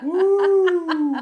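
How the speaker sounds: very high, loud mock "woo"; long, piercing falsetto cheer; show-off whoop. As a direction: let out a hammed-up gym whoop. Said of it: "And they're like".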